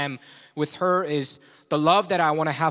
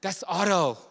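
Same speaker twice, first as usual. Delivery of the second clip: exasperated; 5 semitones higher, slower than usual. Retold or imitated. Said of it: "I'm like".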